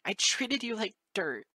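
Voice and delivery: snarl. Acted. Moon Dancer correcting Lyra, angry snarl. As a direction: spit it back at her. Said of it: "she snarls".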